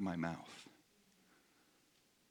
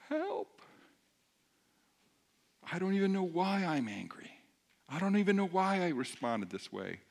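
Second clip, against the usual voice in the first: higher, mildly helpless cry; acted plea for help; 6 semitones higher than usual. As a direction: plead as if at a loss.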